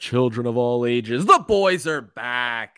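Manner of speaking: booming, drawn-out showman announcement; ringmaster. Acted. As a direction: open the show like a circus ringmaster. Podcast intro, announcer style.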